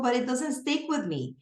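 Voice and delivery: usual speaking voice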